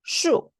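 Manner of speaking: 슈 short, falling from very high; word reading in tone. Shown as a demonstration. Teacher reading a word for students, high falling 슈. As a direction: start 슈 high and drop it. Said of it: "제가 한번 읽어볼게요".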